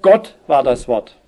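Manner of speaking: "Gott" hit hard at the front, the rest falling away; stress on GOTT. Shown as a demonstration. Preacher showing why word order matters, heavy stress on "Gott". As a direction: punch the first word "Gott", then drop. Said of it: "weil es eben gerade die Betonung ist".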